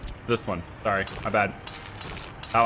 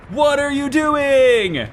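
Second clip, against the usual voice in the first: drawn-out, high exasperated cry; exasperated outcry; 5 semitones higher, much slower than usual. Real, not acted. Player to citizens leaving their jobs, exasperated. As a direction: stretch it out in disbelief.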